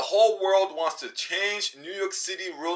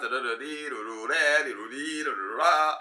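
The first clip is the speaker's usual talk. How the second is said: rapid nonsense babble; fast talk; 4 semitones lower than usual. Shown as a demonstration. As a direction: rattle syllables off to mimic fast talk. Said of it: "We talk fast".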